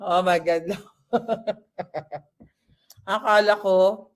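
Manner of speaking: ordinary talk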